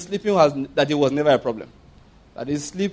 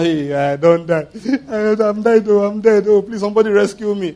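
hammed-up wailing panic, higher; a dramatized tantrum, higher and louder at the peak; mock tantrum; 4 semitones higher, 4 dB louder than usual. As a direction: throw a mock fit, crying out for rescue. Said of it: "That one is called tantrum".

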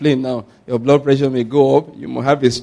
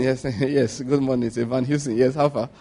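soft, smug self-satisfied reply; mock proud shirt wearer; 4 semitones lower, 8 dB quieter than usual. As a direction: preen quietly over the new shirt. Preacher mimics proud new-shirt wearer.

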